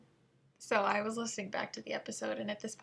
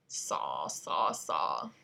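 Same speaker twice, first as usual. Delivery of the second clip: deep comic voice; much slower than usual. Pretend.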